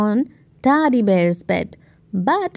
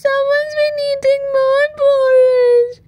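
put-on high, whiny baby bear cry; Baby Bear finding his porridge gone, high tearful wail; 14 semitones higher, 3 dB louder than usual. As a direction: wail like a tiny upset bear. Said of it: "cried Baby Bear".

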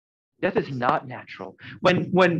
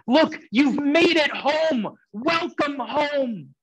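joyful welcome; 10 semitones higher, much slower than usual. Acted. Voicing those welcoming you home.

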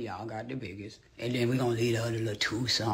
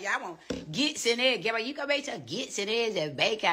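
imitating child; 9 semitones higher than usual. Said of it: "She like".

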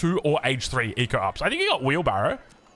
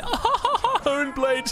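"Honed" said the American way, no h sound and a much higher, laughing voice; mock American accent; 12 semitones higher than usual. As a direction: laughingly imitate an American saying "Honed blades". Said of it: "people from the Americas always just like".

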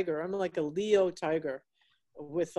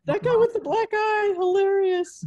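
much higher and louder, gleeful; delighted squeal; 12 semitones higher, 8 dB louder than usual. Real, not acted. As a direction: squeal at the funny pet. Seeing a pet on camera.

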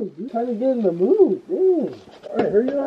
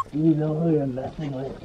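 quiet menacing take; 6 semitones lower, 5 dB quieter than usual. Shown as a demonstration. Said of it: "my brother's acting skills".

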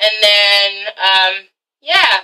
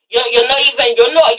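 usual speaking voice

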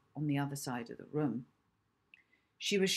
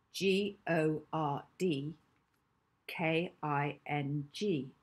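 slow letter by letter; spelled out slowly; 2 semitones lower, slower than usual. Acted. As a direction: spell each letter out deliberately.

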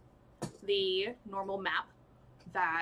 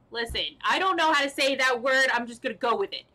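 louder, higher, insistent outburst; raised voice, owning up defensively; loud self-defence; 3 semitones higher, 6 dB louder, faster than usual. Real, not acted. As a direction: push it out loudly, brushing off the doubt. Defends uncertain pronunciation of name.

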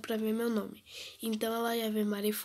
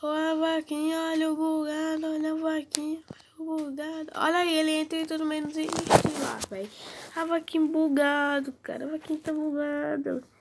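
high, louder teasing chant at the glitch; mocking singsong; 5 semitones higher, 5 dB louder than usual. Real, not acted. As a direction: taunt the buggy cow in a sing-song. (provocando em tom cantado e agudo)

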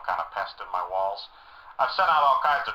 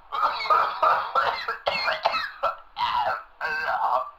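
coughing fit; 4 semitones higher than usual. Acted. Sick caller coughs.

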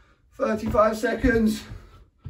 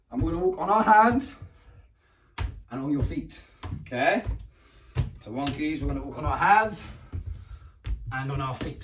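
usual speaking voice

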